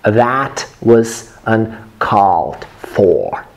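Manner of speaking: very slow, word by word; drawn-out teacher's demo, each word separate; slow model pronunciation. Shown as a demonstration. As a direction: spell it out slowly with a pause after each word. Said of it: "say it like this".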